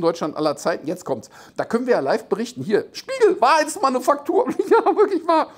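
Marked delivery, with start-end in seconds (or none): 2.6-5.5 high squeal breaking into laughter; laughing mockery. Real, not acted. Host mocking the Spiegel's truthfulness, laughing high. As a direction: crack up while praising with heavy sarcasm.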